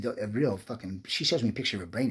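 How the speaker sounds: normal talking voice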